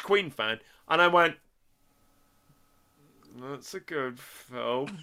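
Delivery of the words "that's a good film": very soft, low and slow; quiet, low, drawn-out reflection; hushed verdict. Retold or imitated. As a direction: murmur it slowly to yourself. His quiet verdict after watching it.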